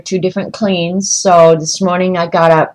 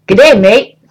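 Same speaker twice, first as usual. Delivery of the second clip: mock Aussie greeting; 4 semitones higher, 5 dB louder than usual. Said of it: "how the kangaroos look at you".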